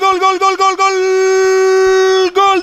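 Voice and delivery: long chanted goal call; goal shout. Real, not acted. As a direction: shout gol over and over. Goal call in live commentary.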